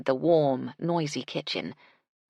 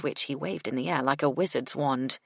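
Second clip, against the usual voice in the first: ordinary delivery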